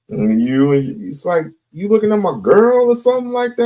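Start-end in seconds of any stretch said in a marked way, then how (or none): 1.7-3.7 put-on jealous boyfriend.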